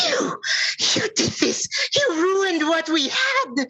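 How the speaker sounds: high, anguished troll outburst; full of anger. Acted. Enraged troll exposed before everyone, high furious cry. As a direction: hurl the accusation, pitch shooting way up. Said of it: "her face full of anger".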